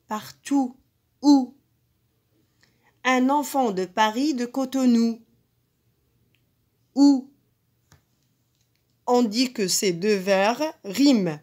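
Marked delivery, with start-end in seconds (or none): 1.2-1.5 Ou called out loud and held to show the rhyme; loud drawn-out ou sound. Shown as a demonstration. Teacher to pupils, calling out the rhyming sound Ou loudly. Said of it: "On entend le son ou". 6.9-7.3 Ou called out loud and held to show the rhyme; loud drawn-out ou sound. Shown as a demonstration. Teacher to pupils, calling out the rhyming sound Ou loudly. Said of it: "On entend le son ou".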